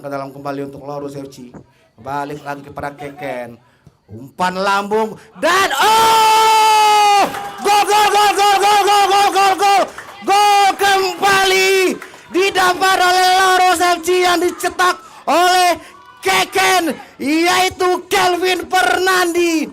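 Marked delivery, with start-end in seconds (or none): 4.1-5.7 rising excitement. Real, not acted. Goal chance in live commentary. 5.8-7.2 excited shout. Real, not acted. Goal being scored. 7.6-19.7 high, loud, drawn-out goal scream; goal call. Real, not acted. Goal call in football commentary.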